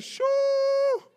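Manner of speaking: high, loud mock shushing mama; mama's high shoo. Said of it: "you said".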